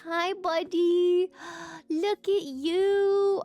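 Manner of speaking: high, loud, drawn-out adoring coo; excited cooing. Real, not acted. Gamer greets the little pig as buddy, squealing adoringly. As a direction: greet a tiny cute animal with a stretched squeal. Gushing over the piggy.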